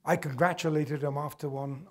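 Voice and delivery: ordinary delivery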